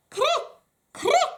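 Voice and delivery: high squeaky cricket call; cricket chirp. Acted.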